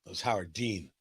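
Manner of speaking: usual speaking voice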